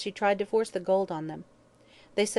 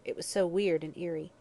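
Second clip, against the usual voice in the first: ordinary delivery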